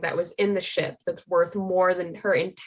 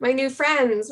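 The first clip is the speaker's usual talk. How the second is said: begging the new friends for help, voice climbing; panicked plea; 6 semitones higher than usual. Acted. Character begging her new friends what to do, panicked and high.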